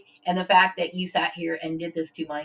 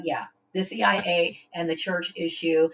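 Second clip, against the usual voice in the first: ordinary talk, nothing marked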